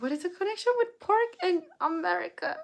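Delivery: high, disbelieving question; incredulous. Real, not acted. Reacting to odd chat.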